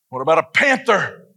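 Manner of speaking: imitated kid leaning hard on "panther"; stress on panther. Daughter repeating her challenge to dad, heavy emphasis on "panther". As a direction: land "panther" with extra punch, as a child would. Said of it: "She put a lot of emphasis on the panther".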